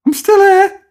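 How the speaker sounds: high, loud outraged voice; a wounded figure shouting back; indignant character. Pretend. Feature character overhearing its killers, high loud outrage.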